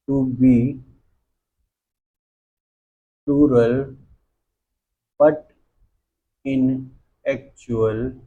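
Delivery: word by word with long pauses between; slow dictation. Shown as a demonstration. Teacher dictates the rule on singular nouns, slow dictation. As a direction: dictate very slowly, leaving time to write each word. Dictates definition for students to write.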